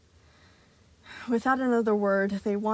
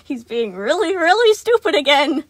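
loud, flustered, hurried; quick apology; 8 semitones higher, 14 dB louder than usual. A narrator's reading. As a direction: rush the apology, laughing nervously. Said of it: "Sun quickly said".

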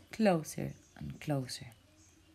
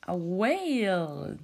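plain reading voice

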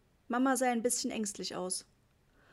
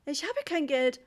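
higher, louder helpless mum; flustered, raised-voice protest; desperate cry; 5 semitones higher, 5 dB louder than usual. A narrator's reading. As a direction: cry out in despair. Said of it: "schrie Mama verzweifelt".

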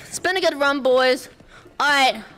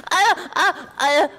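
normal talking voice

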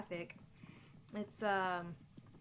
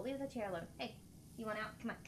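usual speaking voice

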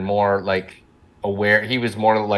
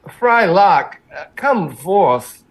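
Christopher Walken voice; 8 semitones higher, 4 dB louder, much slower than usual. Retold or imitated. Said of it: "Christopher Walken impersonator".

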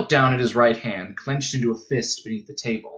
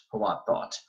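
plain reading voice

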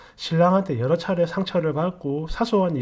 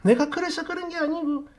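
very high, put-on whiny protest; mock whining; 12 semitones higher than usual. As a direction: make excuses in an exaggerated whiner's voice. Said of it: "징징대지 마라".

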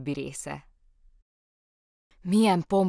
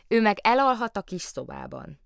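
eager interruption; 6 semitones higher, 4 dB louder than usual. A narrator's reading. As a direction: cut in higher than usual. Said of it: "vágott közbe Lotta".

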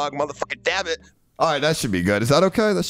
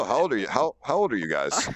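deep pitch shifted; 5 semitones lower, 4 dB quieter than usual. Real, not acted. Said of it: "I'm using a voice changer".

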